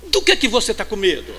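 shouted high, hammering the question; fiery pulpit shout; full-force preaching. Acted. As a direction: preach it out at the top of your voice.

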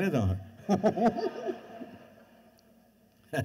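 laughing through a fib, drawn out slowly; can't keep a straight face while lying; laughing lie. Retold or imitated. Telling Quincy it won't hurt, laughing through the lie.

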